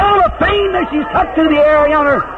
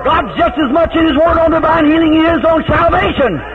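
preaching at full force; 3 semitones higher than usual. Acted. Preacher shouting to the congregation about divine healing, at full force. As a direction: let it build into a full revival shout.

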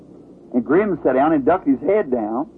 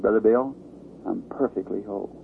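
put-on soft, lower little-boy voice fading away; small child's voice; 4 semitones lower, 6 dB quieter than usual. Little boy telling the preacher he is healed, softly. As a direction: speak it gently like a healed toddler.

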